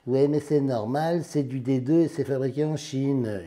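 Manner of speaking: lightly caricatured heckler raising an objection; mock objecting viewer. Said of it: "je sais ce que vous allez me dire".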